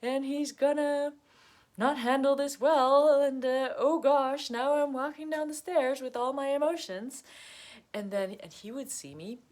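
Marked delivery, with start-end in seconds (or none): 0.0-7.2 mock nervous dread. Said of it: "but I was like".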